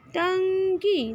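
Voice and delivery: टंकी said slowly, syllable by syllable, pitch up; teacher modelling टंकी slowly for children; slow demo of टंकी. Shown as a demonstration. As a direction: stretch out टंकी with a clear nasal ट-अं-की. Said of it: "न इसमें use किया".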